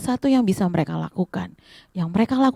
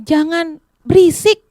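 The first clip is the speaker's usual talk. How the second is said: raised, high reprimand; acted scolding; 7 semitones higher, 5 dB louder than usual. Crowd tells him to hush. (menegur keras bernada tinggi)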